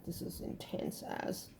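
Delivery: breathy whispered aside; hushed aside after an intense reading. Real, not acted. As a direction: whisper it to yourself in awe.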